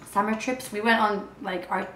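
usual speaking voice

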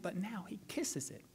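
unvoiced hushed whisper. Real, not acted. Pastor telling how George kisses the knob, whispered. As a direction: breathe the words softly. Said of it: "quiet dramatic moment".

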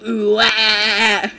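loud imitation. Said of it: "I love that video of the guy".

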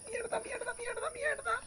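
cursing in a high squeal; frustrated high squeal. Real, not acted. Falla y grita frustrado. (maldiciones en chillido agudo)

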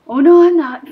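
very loud, high yell; alarmed yell. Real, not acted. Player backing out in the game, alarmed yell. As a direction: blurt it at full volume, pitch up.

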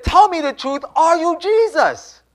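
high, loud, slow imitated plea; urgent demand. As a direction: demand the truth at the top of the voice. Woman demanding the truth.

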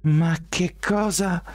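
high, slow baffled murmur; murmured disbelief. A narrator's reading. Shelby watches from the edge, slow baffled murmur. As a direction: mutter it in disbelief. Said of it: "mormorò Shelby".

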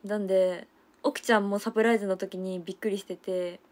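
normal talking voice

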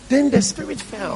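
high, drawn-out preacher's cry; slow, high-pitched announcement; exclaimed proclamation. Real, not acted. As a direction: proclaim the turning point, pitched right up. Preacher proclaims the turning point.

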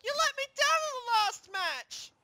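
high-pitched indignant accusation; accusing. Acted. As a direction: lash out at a teammate, high and strained. Acted quarrel between teammates.

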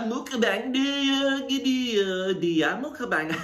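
high, slow, hammed-up Korean accent; badly faked Korean accent, pitched high and stretched out; mock Korean accent. Vlogger jokes before eating, crude mock Korean accent.

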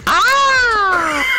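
high scared yell; panicked yell. Real, not acted. Panicked under fire.

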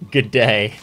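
loud, badly done Australian accent; attempted Aussie accent. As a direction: have a clumsy go at an Aussie accent. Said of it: "with the Aussie accent required".

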